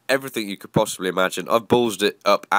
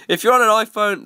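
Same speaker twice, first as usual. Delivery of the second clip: high pitched; 11 semitones higher, 4 dB louder than usual. Real, not acted. Mock pitying aside.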